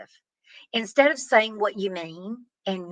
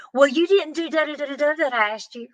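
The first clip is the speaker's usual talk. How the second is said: higher sing-song mock of a nagging spouse; mocking snarky comeback; 7 semitones higher, faster than usual. Mimicking the spouse who throws back a petty complaint, mock sing-song. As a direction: parody the spouse's petty retort.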